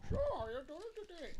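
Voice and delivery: high, soft and fast mimicked line; quiet mock line. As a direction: mimic the barely audible opening dialogue. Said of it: "the beginning of the movie".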